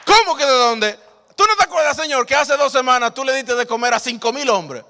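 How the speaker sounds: loud, high excited reminder; eager disciple. Acted. Disciple excitedly reminding the Lord of the miracle, loud and high. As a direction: burst out with faith. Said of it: "la respuesta que el Señor estaba buscando".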